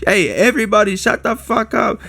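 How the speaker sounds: hamming up a brash fighter telling the crowd to hush; mock rude fighter. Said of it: "Topuria just out of nowhere goes".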